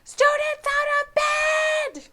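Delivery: shrieking poltergeist raising the alarm; bellowing. A narrator's reading. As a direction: bellow the alarm as loud as you can. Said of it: "Peeves bellowed".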